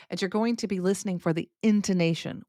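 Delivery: normal talking voice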